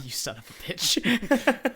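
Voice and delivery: a playful insult through laughter, not meant; laughing mock insult. Real, not acted. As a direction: laugh it out as you call him a name.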